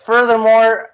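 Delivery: normal talking voice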